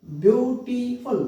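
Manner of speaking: ब्यूटीफुल slowly, part by part; separate syllables. Shown as a demonstration. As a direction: break ब्यूटीफुल into ब्यू, टी, फुल. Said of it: "treated as separate syllables". (ब्यूटीफुल धीरे, हर syllable अलग)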